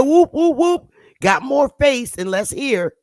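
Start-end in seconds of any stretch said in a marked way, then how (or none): 0.0-0.9 high, hooting ape calls; playful mock monkey hoots; monkey whoops. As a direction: whoop like a chimp.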